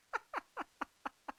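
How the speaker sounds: a short, very high burst of laughter. Real, not acted. Cracking up at the James Bond joke, high laugh. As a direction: laugh at your own joke.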